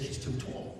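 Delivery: a mother's disapproving whisper; quietly mock-whispered judgement; mum's hushed verdict. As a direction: breathe it out like a disapproving mum. Mum disapproving girlfriend in joke.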